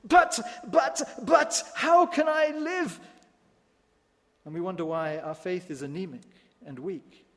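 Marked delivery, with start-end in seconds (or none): 0.0-3.0 high, slow, stammering protest; mock whine. Believer objecting to Jesus' teaching, hammed-up whine. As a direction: stammer out a helpless excuse.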